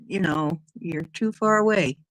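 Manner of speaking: usual speaking voice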